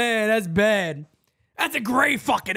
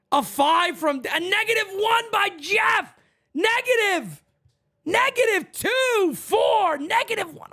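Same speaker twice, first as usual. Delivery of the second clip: excited shout; 8 semitones higher than usual. Real, not acted. Reading chat ratings, shouting.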